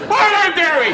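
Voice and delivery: very high, loud confused squawk; high startled bewildered cry. Retold or imitated. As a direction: squeak out in panicked confusion.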